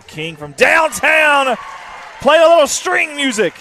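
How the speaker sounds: high, loud, drawn-out announcer shout; soaring play-by-play holler; excited three-point call. Real, not acted. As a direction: belt it out and stretch the words. Calling a made three live.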